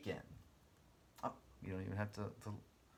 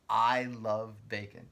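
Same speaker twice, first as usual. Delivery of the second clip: slow emphatic dictation; 9 dB louder, slower than usual. Shown as a demonstration. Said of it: "dictates closing sentence to class".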